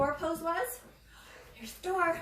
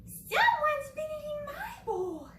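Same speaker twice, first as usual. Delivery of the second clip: much higher, slow Mama Bear voice; high-pitched Mama Bear; slower than usual. Acted. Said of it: "She said".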